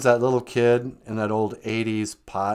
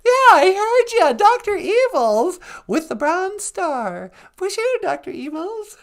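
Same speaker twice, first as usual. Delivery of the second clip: high, loud squealing hello; excited greeting; 5 dB louder than usual. Real, not acted. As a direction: greet Doctor Evils in a loud, much higher squeal. Squealing greeting of Doctor Evils.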